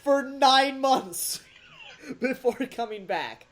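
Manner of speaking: drawn out slow through a laugh; laughing at the absurd plot. Real, not acted. Host laughing to cohost about the ending, slow and amused.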